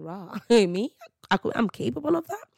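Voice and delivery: high, loud disbelieving questions; acted astonishment with a laugh; incredulous self-questioning. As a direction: question yourself in amused disbelief. Acts out shocked self-questioning thought.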